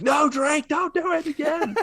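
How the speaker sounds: high-pitched yelling at a game character; mock exasperated yell. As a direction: plead in a hammed-up high cry. Imagined player yelling at game character.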